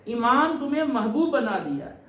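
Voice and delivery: ordinary delivery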